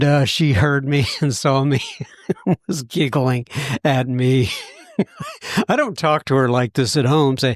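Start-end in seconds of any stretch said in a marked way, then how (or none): 5.0-5.6 high burst of laughter. Real, not acted. Laughing at being caught.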